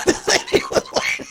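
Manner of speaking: said through laughter, very high; laughing. Real, not acted. Cornbread story, laughing repeat. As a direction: laugh out the punchline.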